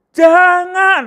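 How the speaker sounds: jangan very high and loud, falling; a shrill warning jangan; different intonation. Shown as a demonstration. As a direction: cry out jangan high and sharp. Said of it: "dari tiga ini sudah beda".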